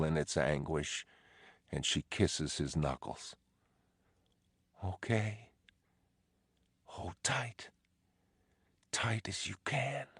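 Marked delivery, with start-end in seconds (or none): 4.8-10.1 higher, much softer, fading to a whisper; wife's last comfort, whispered. Acted. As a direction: soothe him as she slips away. Dying wife comforts husband softly.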